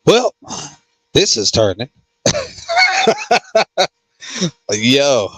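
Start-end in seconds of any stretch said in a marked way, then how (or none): 2.2-3.9 high-pitched burst of laughter. Real, not acted. Host reacting to the comments as talk turns, high laughter. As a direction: laugh long and high, well above speaking pitch.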